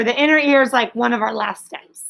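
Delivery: ordinary delivery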